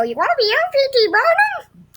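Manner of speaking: loud, high-pitched put-on voice; put-on casting director voice. Casting director offering a child the dream role, high silly voice. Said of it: "Maybe not in that voice".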